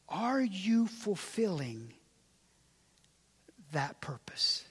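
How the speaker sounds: quiet tender sermon close; hushed intimate preaching. Acted. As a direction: lean in and plead gently with the congregation. Hushed appeal to the congregation.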